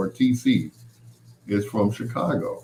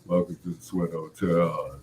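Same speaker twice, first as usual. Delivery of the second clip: low, soft radio-host imitation as if holding the nose; 5 semitones lower, 7 dB quieter than usual. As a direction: mimic a pinched-nose voice, pitched down and quieter. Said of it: "It sounded like he was holding his nose".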